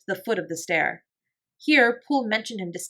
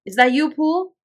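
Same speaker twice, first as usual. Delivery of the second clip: higher, louder wary question; raised, high questioning call; voice from within; 8 semitones higher, 7 dB louder than usual. A narrator's reading. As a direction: ask it through a closed door, pitched up and raised. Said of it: "a voice asked from within".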